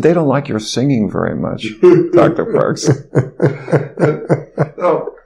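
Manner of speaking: coworker's amused teasing with chuckles; imitated laughing jibe. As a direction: break into high chuckles at the end. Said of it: "my coworker was laughing".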